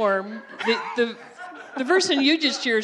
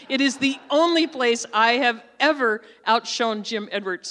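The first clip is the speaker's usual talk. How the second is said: normal talking voice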